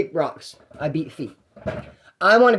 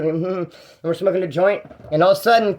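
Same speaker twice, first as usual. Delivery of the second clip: ordinary talk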